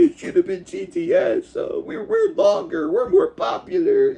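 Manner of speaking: high, whiny crybaby voice; mock whining. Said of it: "I can sit here and cry and say".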